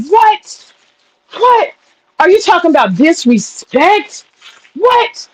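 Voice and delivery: high, repeated stunned "What?"; can't believe he said that; stunned disbelief. Real, not acted. Reacting to the husband's comment, stunned high disbelief.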